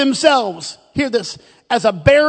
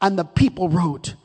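ordinary talk, nothing marked

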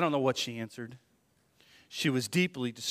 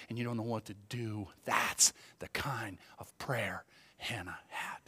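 hushed; 3 semitones lower, 7 dB quieter than usual. Acted. Intimate sermon point.

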